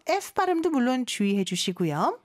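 ordinary talk

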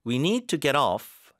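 slow, with weight on "off" in "get off"; slow demo leaning on "off"; stress on off. Shown as a demonstration. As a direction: lean on the back word "off". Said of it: "뒤에 힘 잘 주시고 get off".

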